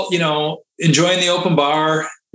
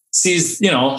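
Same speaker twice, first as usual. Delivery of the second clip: usual speaking voice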